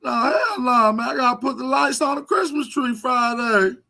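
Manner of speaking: high, loud crybaby caricature; mock whiny arguing. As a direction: argue back as if about to cry. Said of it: "He start arguing".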